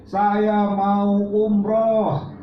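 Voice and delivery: high, very slow, stretched plea; eager plea. Shown as a demonstration. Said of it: "kalau saya bilang lagi". (permohonan panjang bernada tinggi)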